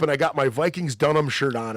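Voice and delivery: ordinary delivery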